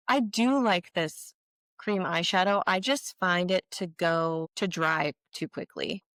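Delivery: usual speaking voice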